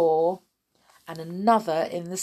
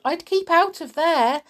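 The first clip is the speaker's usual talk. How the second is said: higher-pitched warning; mildly raised cautioning voice; calling out; 6 semitones higher, 4 dB louder than usual. A narrator's reading. George warns Grandma of attic bugs, cautioning call. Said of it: "George called out".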